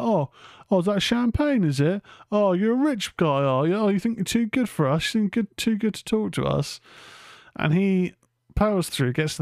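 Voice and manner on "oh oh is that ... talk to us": mocking chav taunt. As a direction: jeer at him like a gang of louts. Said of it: "they're like".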